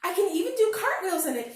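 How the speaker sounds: high, loud whoop of joy. A narrator's reading. Bear cub bragging about cartwheels, high and loud. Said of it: "he whooped".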